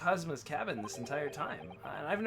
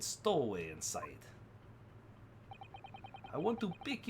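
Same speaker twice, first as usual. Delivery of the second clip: lightly put-on Russian accent, broken English; a hint of a gruff Russian sailor guarding the cabins; 4 semitones higher than usual. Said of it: "the same Russian voice".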